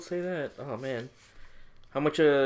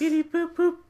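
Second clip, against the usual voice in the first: robot beeps; 4 dB louder, slower than usual. Pretend. Mimicking a computer running simulations.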